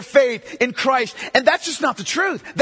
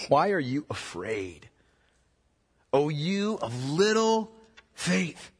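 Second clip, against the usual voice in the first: calm rebuke; 9 semitones lower, 6 dB quieter, slower than usual. Acted. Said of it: "Jesus said to them".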